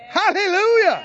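high preacher's shout of praise; exultant praise. Real, not acted. Preacher praising after sensing faith in a house, exultant. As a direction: let it burst out over the congregation.

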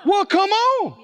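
high, rousing call; eager invitation. Acted. Jesus urging Peter onto the water, calling out eagerly. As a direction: call out high to urge them along.

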